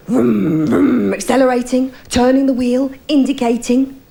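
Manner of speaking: revving engine noises in a laughing voice; sleep-driving wife, vroom and all; mock engine noises. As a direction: act out driving in your sleep. Acting out wife's sleep-driving.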